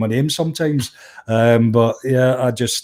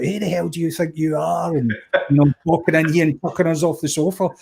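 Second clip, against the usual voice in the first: mock indignation; 4 semitones higher than usual. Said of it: "going like".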